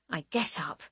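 plain reading voice

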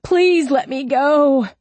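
high, loud, drawn-out plea; desperate begging. A narrator's reading. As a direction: plead loudly for release. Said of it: "Wright begged".

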